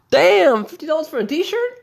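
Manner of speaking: high loud shocked outburst. Acted. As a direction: shriek at the price. Repeat shocked at price.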